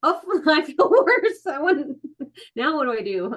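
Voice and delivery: high-pitched, hammed-up distress with a laugh; mock wailing. Client driving home after therapy, mock-distraught wail. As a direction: wail in comic despair, half laughing.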